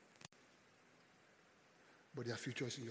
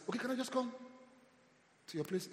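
high pleading ask; 10 semitones higher than usual. Retold or imitated. Pastor asking to stay.

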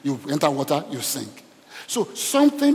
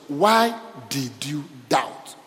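slow rebuke; much slower than usual. Acted. Jesus' question read slowly.

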